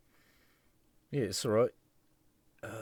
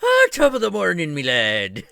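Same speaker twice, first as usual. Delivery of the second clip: high, loud hammed-up Irish greeting; terrible Irish accent; 8 semitones higher, 10 dB louder than usual. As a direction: ham up a fake Irish brogue. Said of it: "my really intentionally terrible Irish accent".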